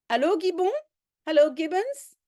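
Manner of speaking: high bright hello into a phone; phone greeting. Acted. Caller greets Gibbons on the phone. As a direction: call out over the line.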